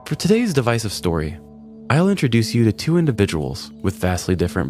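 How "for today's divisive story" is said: plain reading voice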